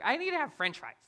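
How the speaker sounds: put-on high-pitched demanding child; playful mimicry of a stubborn daughter; picky little girl. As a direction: imitate a fussy kid ordering food. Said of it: "She's like".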